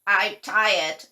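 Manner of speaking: a hammed-up tired groan; tired toad. A narrator's reading. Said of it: "said the toad".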